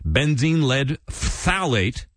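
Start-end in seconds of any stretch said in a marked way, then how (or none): 1.1-2.0 "phthalate" with a drawn-out, spluttering ph-th; spray the first sound of phthalate; spitty PHTHALATE. Shown as a demonstration. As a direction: overdo the opening consonants of phthalate. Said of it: "when you say that word".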